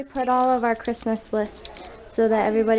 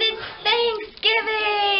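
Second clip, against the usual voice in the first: excited; 9 semitones higher, much slower than usual. Acted. Vlog intro cheer.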